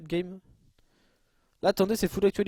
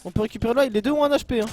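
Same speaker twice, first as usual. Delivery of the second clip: louder, higher urgent call; excited call-out; 4 semitones higher, 6 dB louder than usual. Real, not acted. Spots two one-HP enemies. (annonce excitée et pressante)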